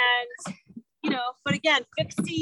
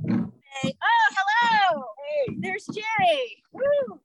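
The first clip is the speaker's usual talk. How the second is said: calling out; 7 semitones higher, slower than usual. Real, not acted. Greeting a friend across water.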